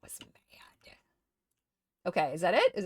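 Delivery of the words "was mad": whispered aside. Real, not acted. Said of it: "I was so angry".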